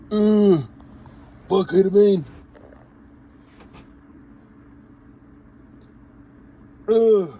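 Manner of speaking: high, loud pained cries; strained in pain. Real, not acted. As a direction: yelp as the needle hits a vein. Injecting oil, hits a vein.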